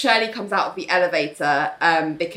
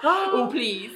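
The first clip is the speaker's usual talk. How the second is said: mock dismissive; 7 semitones higher than usual. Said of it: "And, like".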